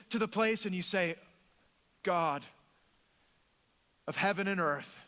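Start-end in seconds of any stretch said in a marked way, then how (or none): 2.0-5.0 acted prayer building from calm to high, impassioned plea; fervent prayer. Churchgoer praying on the way to group, increasingly fervent. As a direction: start measured, climb steadily to a ringing finish. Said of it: "Do we pray that?".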